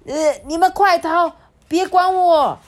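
high, loud cry to flee; high panicked shout; desperate shout. Acted. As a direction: yell at the cubs to run. Wolf demon tells cubs flee.

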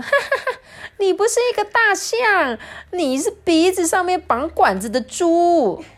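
very high, loud squealing animal voice; cackle, then jeer at the top of the pitch; shouting, mocking kangaroo. A narrator's reading. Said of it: "这时候袋鼠就大叫说". (袋鼠又尖又响地大笑嘲弄)

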